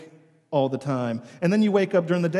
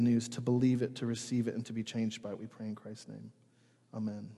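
low, soft pastoral prayer; hushed prayer on behalf of the room; spoken prayer; 3 semitones lower, 4 dB quieter than usual. Real, not acted. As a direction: pray quietly, fading down to the amen. Leads a short prayer.

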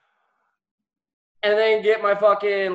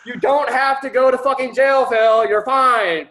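raised pitch, loud, emphatic reassurance; excited good news; 8 semitones higher, 7 dB louder than usual. Retold or imitated. Lawyer telling his client he's free, loud and excited.

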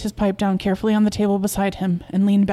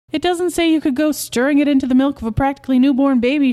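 raised, higher-pitched rebuke; indignant; 6 semitones higher, 5 dB louder than usual. Acted. Wife objects to dosing the newborn's milk, indignant. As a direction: scold him in rising indignation.

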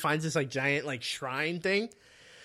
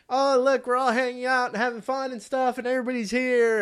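a little higher, cheery party voice; lightly voiced happy kids; 7 semitones higher than usual. Teens enjoying the beach party, lightly higher cheery voice. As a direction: chirp it like carefree teens. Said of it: "And they're like".

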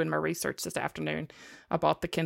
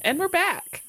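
cheery announcer; 11 semitones higher, 5 dB louder than usual. Acted. Host announcing return on stream.

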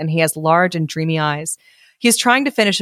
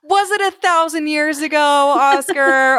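sarcastic question; 9 semitones higher than usual. Real, not acted. Mocking the author's history.

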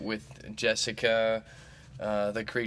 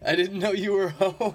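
startled, higher and louder; surprised; 9 semitones higher, 6 dB louder than usual. Real, not acted. Host startled to find roommate home, surprised. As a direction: caught off guard by a voice.

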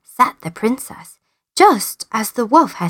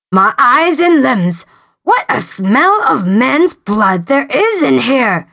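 slow, menacing giant; 3 semitones higher than usual. A narrator's reading. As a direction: sniff out the intruder, slow. Giant smells man again.

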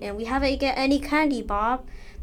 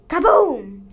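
explosion sound effect; 6 semitones higher, 11 dB louder than usual. Acted.

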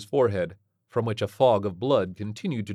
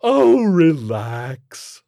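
loud, very drawn-out, higher monster voice; amused alien creature; 8 semitones higher, 10 dB louder, much slower than usual. A narrator's reading. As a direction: tell them off lazily, amused. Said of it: "its fanged maw palpitating with amusement".

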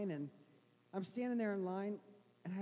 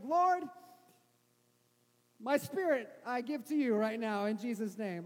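desperate prayer cry; 6 dB louder than usual. Retold or imitated. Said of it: "I was like".

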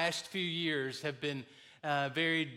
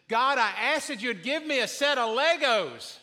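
put-on raised, higher child's voice praying; loud child prayer; 6 semitones higher, 5 dB louder than usual. Boy praying at bedtime, starting to get loud. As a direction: ask God a bit louder. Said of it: "he was getting louder and louder".

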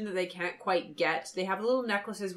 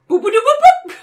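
robot noise; 14 semitones higher, 15 dB louder than usual. Retold or imitated. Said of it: "He makes a robot noise at them".

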